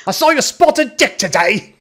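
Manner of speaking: put-on over-the-top Cockney accent; mock cockney. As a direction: ham it up like a Cockney flirting. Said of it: "horrible accent that he hates".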